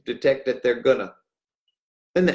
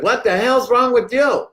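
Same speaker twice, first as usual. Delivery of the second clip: staff yelling; 10 semitones higher, 4 dB louder than usual. Retold or imitated. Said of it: "They came out and said".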